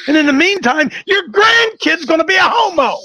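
preaching shout. Real, not acted. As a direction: hammer it out at the crowd. Preacher shouts his point.

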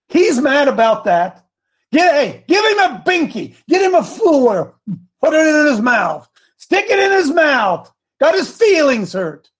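high, hammed-up shouts of ridicule; mocking tirade. Host ridiculing an offended listener as a baby, mocking.

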